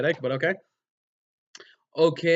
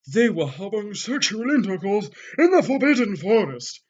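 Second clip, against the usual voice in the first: loud, higher outraged yell; furious yell; 7 semitones higher, 5 dB louder than usual. Acted. Professor furiously reports the forest scandal, yelling. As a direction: yell it in a fury. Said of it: "he yelled in a furious voice".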